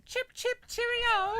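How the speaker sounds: very high, cartoonish English accent; hammed-up English accent; mock English accent. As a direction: chirp it in a silly English accent. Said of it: "love your English accent impression".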